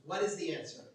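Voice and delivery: normal talking voice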